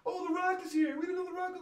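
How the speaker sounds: high mocking falsetto of a clueless announcer; mocking commentator. Vlogger mocking the commentator's call in a high mock voice. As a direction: mimic him in a silly high whine. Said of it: "what the fuck, Michael Cole?".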